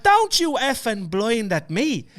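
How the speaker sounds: high, loud mock telling-off, drawn out; arguing border guard. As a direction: imitate a guard arguing back. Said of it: "they were just having this argument".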